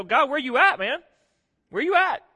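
high, loud, pleading challenge; questioning God. Acted. Believer questioning an absent God, loud high challenge. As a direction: call out upward, pitch jumping on the repeat. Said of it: "Sometimes you just question".

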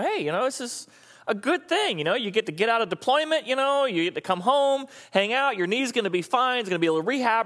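cheerful reassurance. Retold or imitated. She cheers up injured soldier.

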